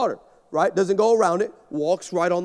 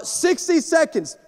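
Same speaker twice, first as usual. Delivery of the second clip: countdown call; 7 semitones higher, slower than usual. Retold or imitated. Producer countdown.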